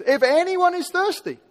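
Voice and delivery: high, loud shouted invitation; loud call. Retold or imitated. Preacher voicing Jesus crying out to the thirsty crowd, loud and high. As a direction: cry it out to the whole crowd. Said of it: "in a loud voice".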